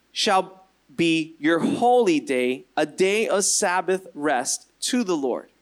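slow, measured verse with long pauses; solemn scripture reading. A narrator's reading. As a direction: read the commandment out deliberately. Reads scripture aloud.

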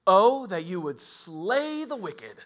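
David's prayer turning. Retold or imitated. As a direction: let the prayer turn hard and slow. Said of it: "he goes from".

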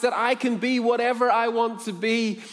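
high, loud, eager question; growing amazement. Acted. Freed slave girl presses her buyer, astonished. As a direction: ask it as hope bursts out.

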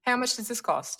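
light put-on Australian accent; a touch of Aussie accent, demonstrated on request. Shown as a demonstration. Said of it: "with an Australian accent".

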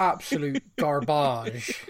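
put-on slow, drawn-out posh English accent; a light posh English accent. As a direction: say it like a snooty English gent. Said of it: "I say it in a posh accent".

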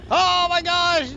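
loud, slow delighted gasp; excited exclaim. Real, not acted. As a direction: shout out with delight. Reacting to sub gift.

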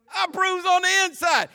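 high, shouted comic boast; hammed-up, loud comedian impression; Eddie Murphy. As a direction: shout it like a movie punchline. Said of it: "I tell you what Eddie said".

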